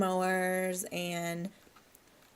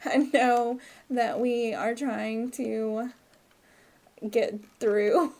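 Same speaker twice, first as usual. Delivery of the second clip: normal talking voice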